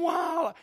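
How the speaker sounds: high-pitched mock baby wail; imitated baby scream. Preacher imitating his babies after bedtime, high mock scream. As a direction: mimic a screaming infant. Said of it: "they'd start screaming".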